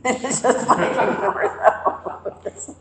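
laughing through the words, slowly. Real, not acted. Laughing at all the red flags in the movie. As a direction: let the words break up with laughter.

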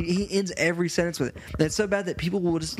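ordinary delivery

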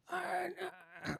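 soft choked attempts to speak; strangled groans. Man showing how his voice was held, strangled sounds. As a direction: imitate a voice caught in the throat. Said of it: "Something had a hold of my voice".

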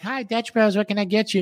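mock barista greeting over the speaker; drive-thru worker voice. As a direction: play the chirpy barista at the window. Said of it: "And they're like".

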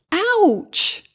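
high-pitched storybook "ouch"; a playful cry of pain. A narrator's reading. Bug steps on prickly thorns.

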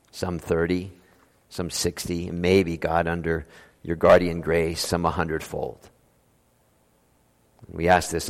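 pastoral prayer. Acted. Pastor praying before the sermon for understanding, soft earnest prayer. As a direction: pray aloud, humble and pleading.

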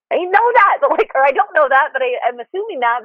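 scared wife brushing off her husband's comfort, high and anxious; anxious protest. Retold or imitated. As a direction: push back at the reassurance in a high, rattled voice. Voicing her scared reply to husband.

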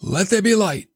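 high, proclaimed divine command; Creator's command. Acted. Preacher voicing the Creator at creation, high proclaimed command. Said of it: "He's the one that said".

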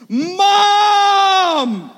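long, high, very loud wail; full-lung scream. Retold or imitated. Terrified boy on a calf screams for his mother, screaming. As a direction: scream desperately for help, drawn out. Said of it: "I scream at the top of my lungs".

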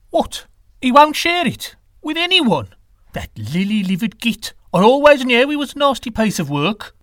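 high, outraged character voice; indignant outburst, pitched well up; livid wind god. A narrator's reading. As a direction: react with scandalised disbelief. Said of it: "Quetzalcoatl was livid".

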